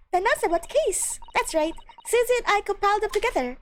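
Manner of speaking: high, eager character voice; high voice, brisk and proud; high-voiced game character. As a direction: play a high-pitched, helpful character.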